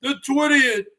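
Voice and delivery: loud, fast rant opener; ranting shout. Real, not acted. Streamer yelling at his viewers, loud and fast. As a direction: bark it at the chat.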